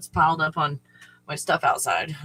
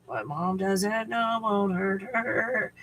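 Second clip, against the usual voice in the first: sly slow saying; 3 semitones higher, 4 dB quieter, much slower than usual. Pretend. Sly motto.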